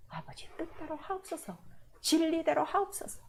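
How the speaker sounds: soft, devout whisper; hushed prayer. Acted. Believer surrendering to the Father, soft and hushed.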